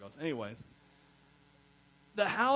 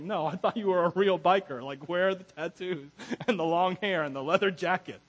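loud, animated imitation of a doubting friend; hammed-up, louder protest; mock incredulous; 6 dB louder than usual. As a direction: challenge the claim with playful disbelief. Acts out friend's incredulous challenge.

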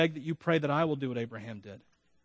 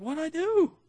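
confused husband; 13 semitones higher, 3 dB louder, slower than usual. Retold or imitated. Voicing himself, confused, to wife.

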